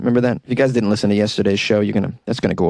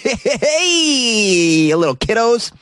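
very high, slow, drawn-out singsong greeting; creepy kids' host voice; 11 semitones higher, much slower than usual. Acted. Host greeting listeners at the outro as a creepy kids' entertainer, very high and drawn out. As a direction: stretch it like a sinister children's host.

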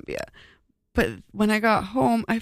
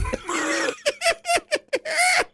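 high shrieking witch cackle. Real, not acted. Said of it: "that witch-like cackle was great".

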